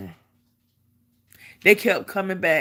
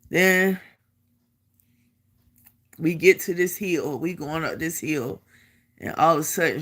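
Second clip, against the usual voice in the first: ordinary delivery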